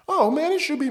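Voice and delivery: high falsetto whiny coworker voice; mocking coworker. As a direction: squeak it out like the nagging buddy. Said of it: "And the guy was like".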